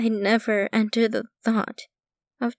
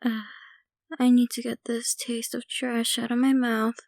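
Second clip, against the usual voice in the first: usual speaking voice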